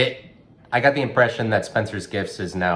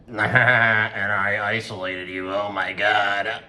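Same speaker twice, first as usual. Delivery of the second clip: slow, drawn-out put-on whine; mock dismay; 3 semitones higher, slower than usual. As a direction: drag it out in hammed-up horror. Mock dismay at alienating listeners.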